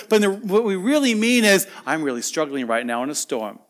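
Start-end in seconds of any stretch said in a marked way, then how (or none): 1.9-3.6 low, soft admission; quiet confession. Acted. Churchgoer admitting the truth behind saying good, quietly. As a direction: let the hidden struggle slip out softly.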